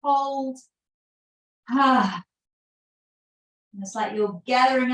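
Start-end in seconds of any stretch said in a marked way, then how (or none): 1.7-2.2 loud "ha" on the out-breath; audible sighing exhale. Shown as a demonstration. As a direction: sigh it all out after holding. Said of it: "sighing the breath out".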